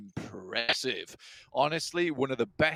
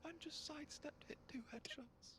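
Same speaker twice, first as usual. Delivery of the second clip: barely audible, awestruck whispering; hushed with shock; stunned whisper; 14 semitones higher, 24 dB quieter than usual. Real, not acted. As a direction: whisper it in real disbelief. Caster stunned by the play.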